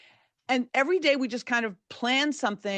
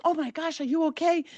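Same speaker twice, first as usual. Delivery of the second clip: alarmed concern; 7 semitones higher than usual. Retold or imitated. Patients rush to hurt Davy.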